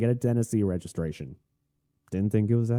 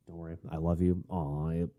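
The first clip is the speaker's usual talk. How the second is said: low, soft, tender aside; soothing a pet; 4 semitones lower, 6 dB quieter than usual. Real, not acted. Podcaster comforting the attention-seeking cat, tender and low. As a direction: softly reassure the animal.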